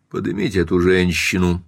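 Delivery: ordinary delivery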